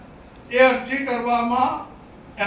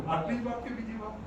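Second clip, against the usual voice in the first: ordinary talk, nothing marked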